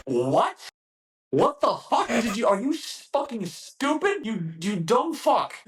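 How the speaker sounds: high, incredulous mock tirade; enraged drill instructor impression; imitated screaming instructor. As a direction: chew out a dumb recruit in a high yell. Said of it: "screamed at you for your answer".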